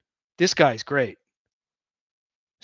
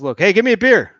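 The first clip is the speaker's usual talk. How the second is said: demanding beer; 5 semitones higher, 9 dB louder than usual. Acted.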